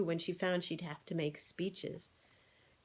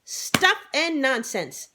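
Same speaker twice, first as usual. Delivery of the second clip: slow, loud, forceful dismissal; brusque scolding hen; vigorous; 7 semitones higher, 9 dB louder, slower than usual. A narrator's reading. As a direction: cut him off hard. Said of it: "interrupted Henrietta vigorously".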